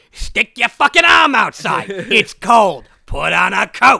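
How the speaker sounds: loud, slow, abusive smart speaker; Gilbert Gottfried. Acted. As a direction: yell it as a rude comic assistant. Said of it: "I did the voice".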